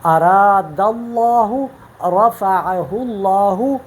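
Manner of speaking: very slow اراد اللہ and رفعہ اللہ with a full, heavy lam; اللہ said with a heavy, rounded lam; heavy tafkheem. Shown as a demonstration. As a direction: recite both phrases with a thick lam. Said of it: "اگر میں پڑھوں".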